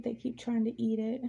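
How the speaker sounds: ordinary talk, nothing marked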